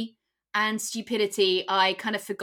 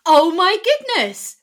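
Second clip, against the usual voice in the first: surprised; 9 semitones higher, 12 dB louder, slower than usual. Real, not acted. Surprised exclamation at start.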